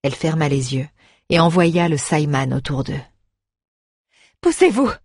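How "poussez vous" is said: high-pitched shouted order; crying out. A narrator's reading. Young woman clears friends from the door, crying out. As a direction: cry the order out, high and sudden. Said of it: "cria-t-elle".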